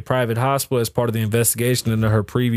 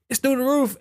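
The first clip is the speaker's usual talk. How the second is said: high exclaim; 13 semitones higher than usual. Acted. Repeated take.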